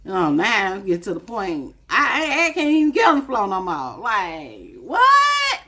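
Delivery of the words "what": incredulous what. Real, not acted. Disbelief at not reaching floor.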